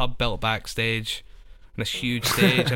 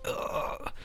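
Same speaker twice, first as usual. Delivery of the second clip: breathy ugh of dread; a tired exhaled ugh; mock reluctant groan; weary unvoiced groan; 8 dB quieter than usual. Mimicking reluctance.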